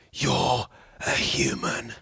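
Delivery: a hushed, breathy mock aside; mock whisper. Podcaster whispering a mocking retort to critics. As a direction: whisper it like a sly jab.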